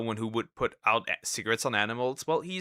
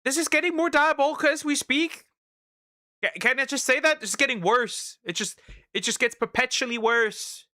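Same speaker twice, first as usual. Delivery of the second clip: high, agitated disbelief; shocked; 12 semitones higher, 3 dB louder than usual. Real, not acted. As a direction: rise up in outrage. Shocked reaction to video.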